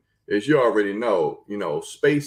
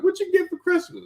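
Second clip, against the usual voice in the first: put-on squealing baby talk aunt. People asking about gifts.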